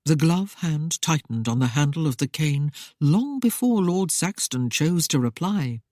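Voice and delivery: ordinary talk, nothing marked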